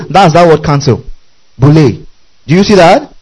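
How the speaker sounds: ordinary talk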